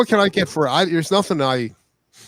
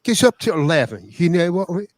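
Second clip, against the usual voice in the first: high, cheeky put-on British accent, amp bragging; hammed-up mock British; 7 semitones higher than usual. Boasting about his amp to friends, mock British accent. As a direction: brag like a rock Brit in a put-on accent. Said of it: "You do it much better".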